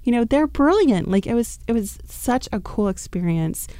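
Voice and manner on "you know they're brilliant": much higher, awed exclamation; amazed realization. Retold or imitated. Realizing Disney's brilliance, high amazed exclamation. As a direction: gasp at a sudden insight, pitched way up. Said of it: "I was like".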